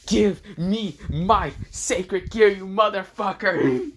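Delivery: high, slow, drawn-out angry yell; angry demand. Acted. Hero trying to activate his sacred gear, drawn-out yell. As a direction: drag out each word of the furious demand.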